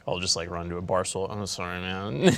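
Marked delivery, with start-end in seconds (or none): 1.3-2.3 put-on drunk apology. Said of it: "Yeah, drunk".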